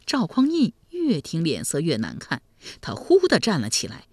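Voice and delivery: usual speaking voice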